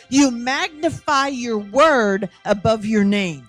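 ordinary delivery

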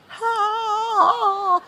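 long high mock wail; helpless moan. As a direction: moan out wordlessly, high and drawn out. Acts her helpless wordless cry.

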